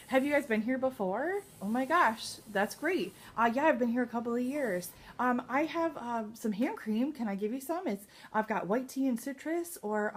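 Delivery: bubbly Mary Kay rep greeting a guest; full greeting demo. Shown as a demonstration. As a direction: greet every guest like an old friend. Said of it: "kind of conversation goes like this".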